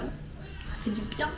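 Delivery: slow, soft whispered relief; whispering. Real, not acted. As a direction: breathe it out in a whisper. Repeats phrase, then whispers it.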